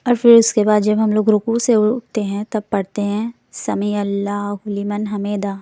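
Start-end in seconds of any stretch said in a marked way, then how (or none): 3.5-5.5 اللہ لمن حمیدہ slow and soft; slow recitation. Shown as a demonstration. Teacher models unhurried recitation. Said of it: "اس میں بھی آپ کو ٹھہراؤ کی ضرورت ہے".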